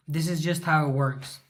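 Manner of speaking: normal talking voice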